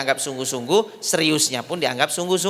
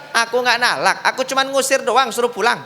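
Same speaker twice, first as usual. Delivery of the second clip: higher-pitched acted protest; defensive husband; 7 semitones higher, 3 dB louder than usual. Husband denying divorce to mother-in-law, defensive. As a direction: protest your innocence in a raised pitch.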